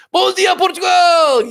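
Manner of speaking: high, slow, loud morning shout; shouted greeting. Real, not acted. Host shouting good morning to the viewers, loud and high. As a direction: belt it out like a radio wake-up call.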